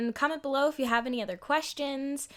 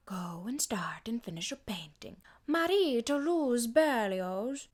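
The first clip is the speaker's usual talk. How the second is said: Duchess voice. Acted. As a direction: lull them with gentle poise. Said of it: "She kinda speaks in a rhythm".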